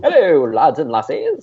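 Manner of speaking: hammed-up Scottish-ish greeting; a fake Brit hailing the crowd; playful put-on Scots voice; mock British accent. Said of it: "have a British accent on the show".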